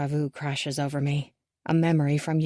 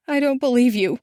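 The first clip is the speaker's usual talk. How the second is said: angry disbelief; 6 semitones higher, 6 dB louder than usual. A narrator's reading. Daughter rejecting father.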